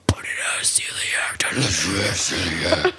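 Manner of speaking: creepy slow whisper; whispered, slow and eerie. Acted. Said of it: "I don't like that voice".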